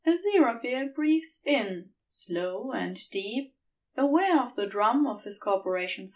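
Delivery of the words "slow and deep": ordinary delivery, nothing marked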